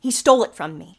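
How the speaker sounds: loud, insistent, still held in; firm angry accusation. Acted. Miss Miller accusing Birch to the detective, controlled anger. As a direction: state the theft with controlled fury.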